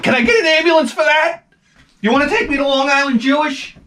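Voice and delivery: mock whiny plea.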